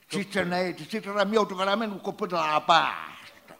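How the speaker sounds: shouting Pugliese dialect, furious; angry dialect rant. Acted. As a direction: yell it like a raging southerner. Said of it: "Così in lingua pugliese".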